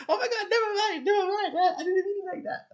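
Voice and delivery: shrill high, loud mock-panicked backpedal; panicked apology. People backpedaling after she pretends to cry, shrill panicked apology. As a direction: imitate them frantically taking it back.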